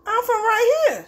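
high-pitched mock-indignant protest; playful insistence. Real, not acted. Teased cook insists she is local, mock-indignant. Insists against teasing about origins.

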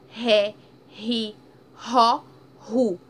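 "ré, ri, ró, ru" loud, each R strong; strong R syllables. Shown as a demonstration. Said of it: "ele vai dar um som mais forte". ("ré, ri, ró, ru" com R forte, mais alto)

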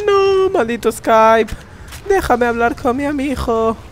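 slow, high theatrical plea; hammed-up despair; mock dramatic plea. Mock complaint at Skype.

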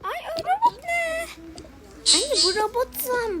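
very high, drawn-out squeal; high-pitched childlike astonishment; startled child. Pretend. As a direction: squeal slowly at mum in amazement.

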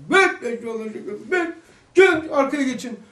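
high, loud scolding yell; angry imam shouting. Retold or imitated. Imam in the mosque yelling at children, angry shout. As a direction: bark at the kids to move back. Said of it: "Çocuklara nasıl kızıyor camide?".

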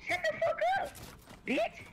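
sudden high-pitched yell at a friend; shrieking shut up, pitched far up; angry yell. Real, not acted. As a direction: screech it way up high in anger. Angry trash talk at friend.

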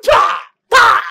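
high, loud scolding yell; furious scold; angry shout. Acted. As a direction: shout at her in shame.

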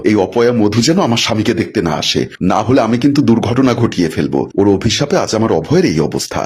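ordinary delivery

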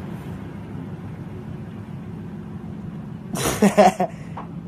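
long, high, breathy burst of laughter. Real, not acted. Streamer cracking up after a call, long high laugh. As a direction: crack up helplessly.

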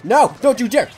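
loud, high warning shout; alarmed shout. Real, not acted. Player warding off an opponent, alarmed shout. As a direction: bark it out in alarm.

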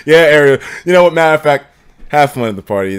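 ordinary delivery

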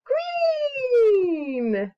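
high, long sung-out green; drawn-out answer. Acted. Answering baby's colour question, high drawn-out delight. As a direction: stretch it out with delight.

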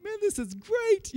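slow, high, savoring every word; awed delight. Retold or imitated. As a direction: let the wonder stretch out. Awed after the hike.

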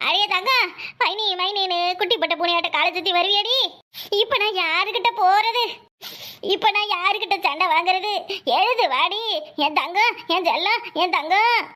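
hammed-up lament to the dead; mock keening; put-on wailing. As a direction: fake grief, dragging out each phrase end. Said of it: "கொஞ்சம் அழுவுற மாதிரி மூஞ்சி வச்சுக்கோ".

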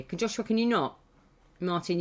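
ordinary talk, nothing marked

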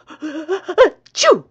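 big explosive sneeze; loud sneeze. Acted. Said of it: "he spluttered".

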